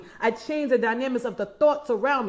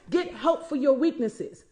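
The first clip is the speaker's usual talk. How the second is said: ordinary talk, nothing marked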